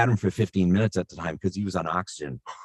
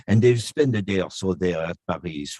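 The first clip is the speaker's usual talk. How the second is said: put-on French accent. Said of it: "do the tour of Europe".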